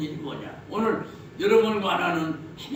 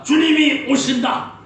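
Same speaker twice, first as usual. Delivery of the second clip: proclaiming; 8 semitones higher, 8 dB louder than usual. Acted. Preacher proclaims the Lord's coming.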